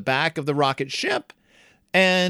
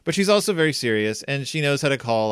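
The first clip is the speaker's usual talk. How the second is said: normal talking voice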